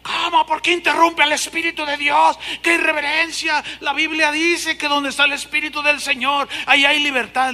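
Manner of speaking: high, loud offended protest; mock outraged husband; indignant husband. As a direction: scold the preacher for interrupting the Spirit. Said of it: "el marido me dice".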